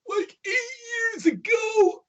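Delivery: high, slow drawn-out cry; dismayed exclamation. Real, not acted. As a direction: wail it out in dismay. Exclaiming how long ago.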